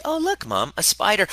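put-on high, light kid's voice, unbothered; curious child voice. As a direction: innocent kid pointing something out. Child calmly points out spider.

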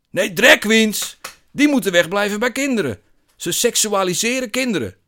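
very high, loud outburst; outraged shouting. A narrator's reading. Man insists about drag queens and children, outraged.

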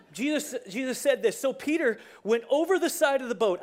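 ordinary talk, nothing marked

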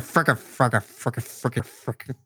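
lightly voiced cartoon villain grumbling; a hint of Dick Dastardly sputtering curses; Dick Dastardly mumble. GM imitating the sore loser's grumble, a hint of Dick Dastardly. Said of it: "like Dick Dastardly talking".

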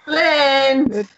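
high, drawn-out call of a name. Acted. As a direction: holler up to someone upstairs. Calling Flint from downstairs.

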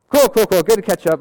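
high, loud mock cheer; breezy sign-off. Ending the call with his friend, breezily upbeat. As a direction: brush the caller off cheerily. Relieved, hurries the call to end.

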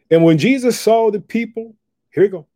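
loud, high preaching burst; full preaching. Acted. Preacher reads to his church, loud and high. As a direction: preach it out to the room.

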